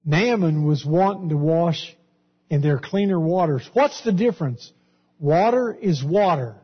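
usual speaking voice

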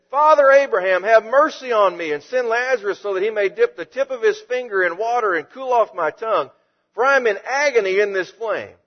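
high, loud plea to Abraham; yelling for mercy. Acted. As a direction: cry out to the father from the flames. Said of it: "imagine even yelling".

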